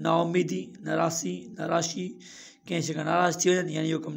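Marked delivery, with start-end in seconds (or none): none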